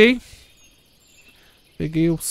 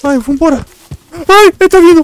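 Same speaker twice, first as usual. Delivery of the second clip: loud high panicked yells; being chased; 12 semitones higher, 11 dB louder than usual. Real, not acted. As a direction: scream to flee, terrified. Monster chasing them.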